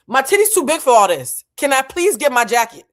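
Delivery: loud, high comic protest; exasperated outburst. Retold or imitated. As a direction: raise pitch and volume in exasperated pleading. Retelling her exasperated plea for jacket.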